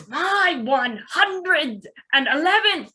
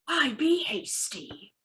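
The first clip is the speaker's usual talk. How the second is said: normal talking voice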